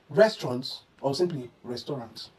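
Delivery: "restaurant" as two-syllable res-tront, then res-to-rant; correct restaurant. Shown as a demonstration. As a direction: say "restaurant" short first, then with three syllables. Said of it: "try pronouncing this as".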